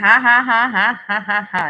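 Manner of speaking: loud scornful laughter, fading; mocking laugh. Real, not acted. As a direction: laugh at the accusation. Mocking the accusations.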